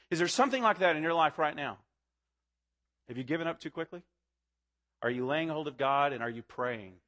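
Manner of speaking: normal talking voice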